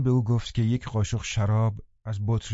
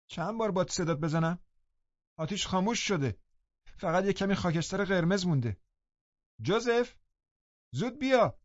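high, soft-voiced sickly boy calling, annoyed; peevish call; 9 semitones higher, 4 dB quieter than usual. A narrator's reading. Sick boy calling for Joseph, high and peevish. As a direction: whine for the servant from the other room. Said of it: "صدای دلخور دیگری".

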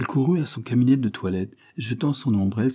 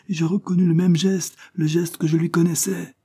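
much higher, rising alarm; agitated revelation; 9 semitones higher, 4 dB louder than usual. Acted. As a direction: burst out with the discovery. Barbara's climactic revelation.

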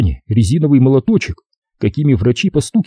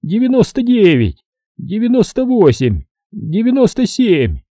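высокий сонный голос, счёт замедляется к концу; считай назад высоко, замедляясь, как под наркозом; засыпающий считает; 10 semitones higher than usual. A narrator's reading. Doctor counts down, dozing off. (сонный обратный счёт высоким голосом)

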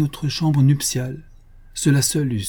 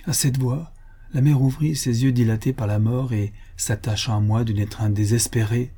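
ordinary delivery

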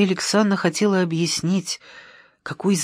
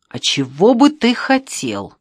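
raised-pitch, annoyed retort; exasperated comeback; irritated; 5 semitones higher, 4 dB louder than usual. A narrator's reading. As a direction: throw the question back irritably. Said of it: "Фёдоров раздражённо".

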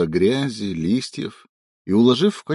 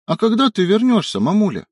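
put-on serious little girl; 11 semitones higher, 4 dB louder than usual. A narrator's reading. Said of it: "серьёзно спросила Поля".

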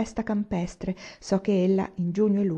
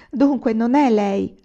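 raised, drawn-out realisation; amazed; 4 semitones higher, 7 dB louder, slower than usual. A narrator's reading. Anania learns it is not her, amazed. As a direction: say it loudly in wonder. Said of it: "aggiunse con meraviglia".

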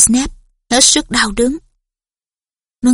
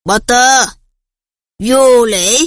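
menacing; 3 dB louder, much slower than usual. A narrator's reading. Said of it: "nói giọng đe dọa nguy hiểm".

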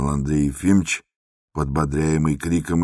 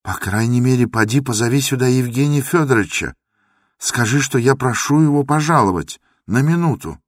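urgent plea; 8 semitones higher, 4 dB louder than usual. Acted. Андрей умоляет позвать врача.

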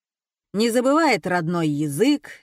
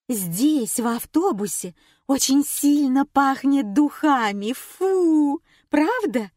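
fussy prim complaint; 6 semitones higher, 4 dB louder than usual. Acted. Passenger complaining about perfume.

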